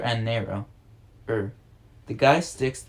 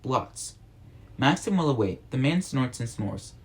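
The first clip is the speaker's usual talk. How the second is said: usual speaking voice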